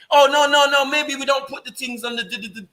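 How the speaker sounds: high-pitched mocking backpedal; mock backtracking. Panelist mimics another man taking back his words, mock backtracking. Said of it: "you're backtracking".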